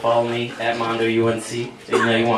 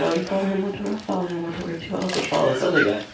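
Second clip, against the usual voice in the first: lightly voiced, higher-pitched cashier; a hint of an eager fan, pitched up; eager nerdy cashier; 7 semitones higher, 3 dB quieter, faster than usual. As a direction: imitate a keen shopgirl. Acted cashier's eager replies.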